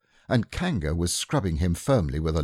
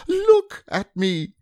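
very high, slow, louder squeal; shouting; 4 dB louder, slower than usual. Acted. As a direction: demand to be seen. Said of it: "shouted Piglet".

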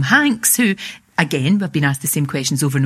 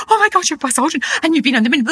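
fangirling; 9 semitones higher than usual. Retold or imitated. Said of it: "I was just fangirling".

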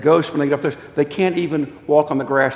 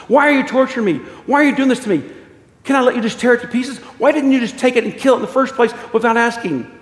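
high, loud, pleading complaint; acted frantic questioning; anguished protest; 9 semitones higher, 4 dB louder, faster than usual. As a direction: plead as if being tortured. Tortured ghost protests.

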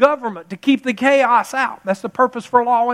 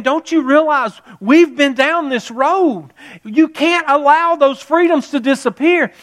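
higher, drawn-out impassioned retelling; urgent retold warning, pitched up; passionate plea; 6 semitones higher, 4 dB louder than usual. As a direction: plead loudly as the man who has seen it before. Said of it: "he's so passionate".

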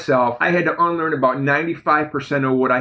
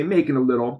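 usual speaking voice

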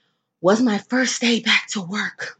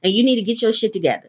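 imitating producer; 4 semitones higher, 3 dB louder than usual. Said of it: "So he was like".

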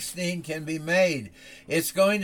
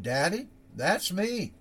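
put-on grinning child voice; 4 semitones higher than usual. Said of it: "says with a grin".

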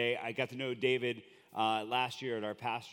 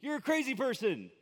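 incredulous crowd; 11 semitones higher than usual. Retold or imitated. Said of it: "You would have thought".

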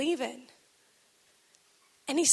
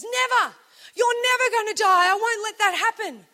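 loud, higher and insistent refusal; Peter's loud protest; 6 semitones higher, 5 dB louder than usual. Retold or imitated. Peter refusing to let Jesus die, loud and high. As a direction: cry out that it will never happen.

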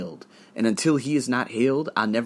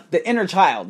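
a gasp, then the words pop out higher and louder; sudden realization; 7 semitones higher, 4 dB louder than usual. Real, not acted. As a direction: catch it like a lost thought coming back. Suddenly remembers the point.

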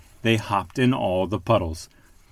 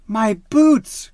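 higher, louder happy cry; delighted mother duck; 11 semitones higher, 7 dB louder than usual. A narrator's reading. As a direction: exclaim with joy as mother duck. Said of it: "cried Missus Duck".

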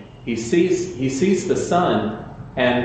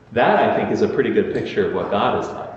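ordinary delivery

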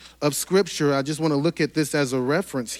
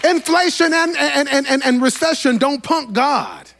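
higher and louder, rising preacher's cadence; full-force preaching; 10 semitones higher, 5 dB louder than usual. Acted. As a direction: preach it with mounting fire to the church.

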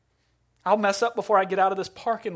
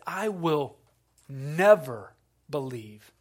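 defiant; 2 semitones lower, slower than usual. Retold or imitated. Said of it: "That's a cry of defiance".

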